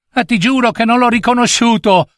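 put-on high, indignant child's protest; angry boy. A narrator's reading. Boy swears he didn't recognise the thief, angry.

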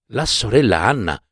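usual speaking voice

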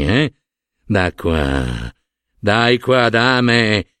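low, moralizing priestly lecture, stretched key words; sanctimonious monsignor. Acted. Monsignor lecturing Don Arturo on sinners' vices, sanctimonious. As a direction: drop the pitch and sermonize knowingly. Monsignor's sermonizing speech, in role.

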